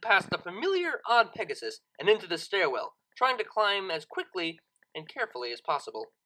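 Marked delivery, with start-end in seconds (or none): none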